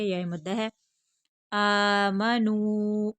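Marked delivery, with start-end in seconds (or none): 1.5-3.1 आमनू very slow, long आ and long नू; drawn-out vowels. Shown as a demonstration. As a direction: stretch both ends of आमनू. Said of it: "Hamza को खींच कर पढ़ेंगे". (आमनू, खींच कर)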